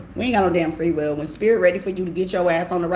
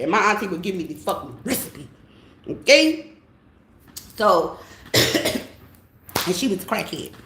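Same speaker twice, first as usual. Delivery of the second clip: usual speaking voice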